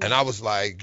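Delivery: ordinary talk, nothing marked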